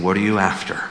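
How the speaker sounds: a kind teacher testing a father; gracious question. Retold or imitated. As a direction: ask gently, no judgment in it. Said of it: "but with a gracious tone".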